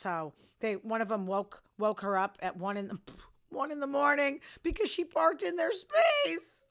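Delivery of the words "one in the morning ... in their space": laughing through the words, higher and louder. Real, not acted. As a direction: chuckle your way through it. Laughing while telling neighbor story.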